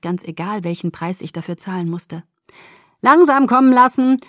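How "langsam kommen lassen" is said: lightly voiced, a touch louder and higher; encouraging Jacob. A narrator's reading. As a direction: coax her gently on, a hint of Jacob. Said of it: "sagte Jacob aufmunternd".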